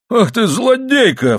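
high-pitched, drawn-out outcry; crying out. A narrator's reading. Husband jumps at wife's cold flannel, crying out. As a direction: cry out in affronted shock. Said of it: "вскричал он".

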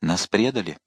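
softly spoken, subdued; quiet. A narrator's reading. The king realising his troops will not follow, quietly. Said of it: "тихо сказал король".

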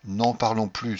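ordinary delivery, nothing marked

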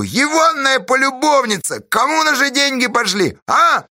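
very high, accusing cry; denouncing shout. Acted. Pozdey denouncing the mistress, accusing shout. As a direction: expose them loudly to the crowd. Denounces a woman before crowd.

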